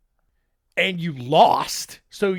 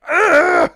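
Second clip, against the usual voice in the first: loud, high groan of disgust; loud disgusted ugh; 9 semitones higher, 9 dB louder than usual. Acted. Public recoils in disgust.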